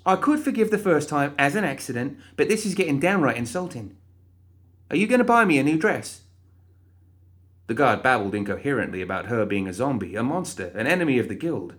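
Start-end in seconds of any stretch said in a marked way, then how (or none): 0.0-6.2 shrill exasperated complaint; frustrated outburst. Acted. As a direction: wail in outraged disbelief. Molly scolds the guard.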